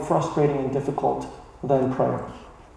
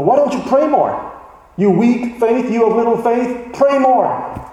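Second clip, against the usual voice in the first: a hint of a preacher scolding, higher and raised; mock scolding preacher; 6 semitones higher than usual. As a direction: lightly play a pastor berating his flock. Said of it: "just start beating people over".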